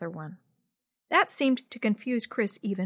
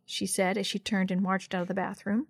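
ordinary delivery

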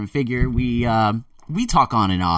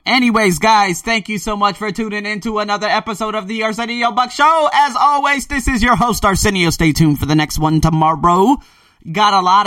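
higher, louder upbeat announcer voice; host sign-off; 10 semitones higher, 6 dB louder than usual. Acted. Host signing off to his podcast listeners, announcer-style. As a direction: sign off the show with big host energy.

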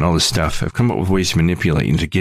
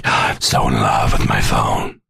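much lower, slow dreamy voice; mock lovestruck; 10 semitones lower, slower than usual. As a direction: moon over the phone like a smitten fool. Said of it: "They're like".

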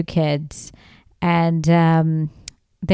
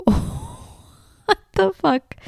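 slow, high, breathy disbelief; stunned reaction; 12 semitones higher, much slower than usual. Retold or imitated. Couple glance at each other after the billboard, mock stunned. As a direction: gasp it out high and drawn out, mock shock. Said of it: "looked at each other like".

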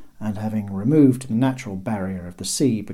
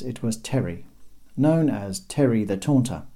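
ordinary delivery

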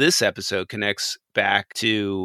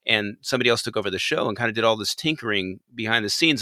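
usual speaking voice

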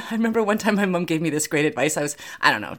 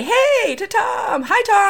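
excited greeting; 12 semitones higher, slower than usual. Real, not acted. Greeting guest on show.

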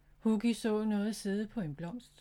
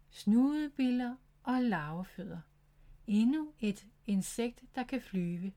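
ordinary delivery

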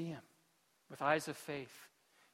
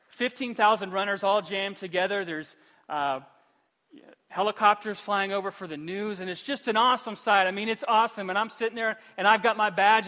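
usual speaking voice

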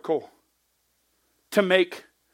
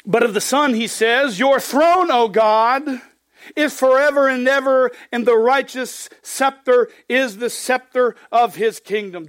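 raised, ringing scripture read; 5 semitones higher, 3 dB louder than usual. Acted. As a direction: proclaim the verse to the congregation. Reads scripture aloud.

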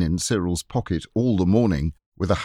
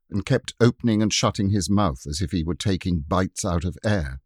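ordinary delivery, nothing marked

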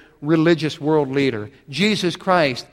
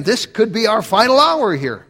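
higher, louder panicked thought; panicked demons; 7 semitones higher, 5 dB louder than usual. Retold or imitated. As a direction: let the demons' dread spill out. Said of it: "And they're thinking".

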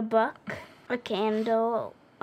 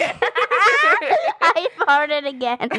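laughing; 7 semitones higher, 5 dB louder, much slower than usual. Real, not acted. Laughing fart confession.

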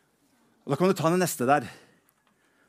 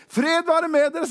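mock cheerful greeting; 10 semitones higher, 4 dB louder than usual. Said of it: "Han sier".

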